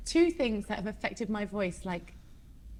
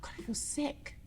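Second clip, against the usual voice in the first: whisper; 5 semitones higher, 10 dB quieter than usual. Retold or imitated. Feeling ill after the show.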